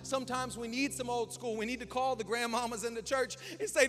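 loud, high preaching build; fiery preaching. Acted. Pastor preaching hard to the church, loud and high. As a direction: preach it out to the congregation.

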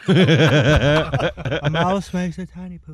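reading through laughter, struggling; laughing while reading. Real, not acted. Reading book while mouth burns.

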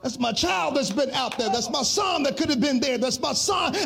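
rising, chanted sermon cadence; preaching. Acted. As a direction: preach it, building with each line. Sermon refrain, sung preaching.

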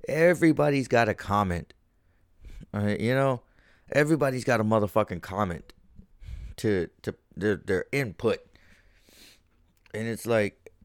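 ordinary talk, nothing marked

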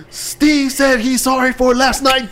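high slow announcer voice. Pretend. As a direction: hype it up like a ring announcer. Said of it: "be like".

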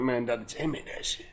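low, quiet broken-English voice. Retold or imitated. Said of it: "In broken ass English".

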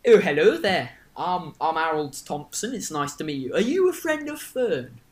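put-on rough Northern English character accent; Harold's accent. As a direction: greet them in a bouncy Northern English accent. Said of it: "It's Harold's accent".

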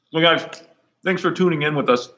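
talking through a mouthful; words round a bite of food; mouth full. Real, not acted. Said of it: "as I speak with my mouth full".

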